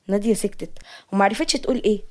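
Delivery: usual speaking voice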